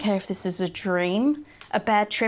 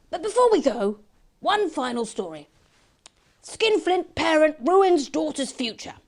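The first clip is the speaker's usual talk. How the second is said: a higher, brisk broadcast read; news anchor voice; 4 semitones higher than usual. Acted. The dreamed news anchor signs off the show, in a higher, brisk broadcast voice. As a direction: deliver it like a tabloid newsreader. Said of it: "I thought I had the voice down".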